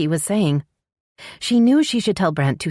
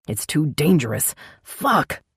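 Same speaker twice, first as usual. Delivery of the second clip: snarl; slower than usual. Acted. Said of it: "Brent snarled, sounding torn".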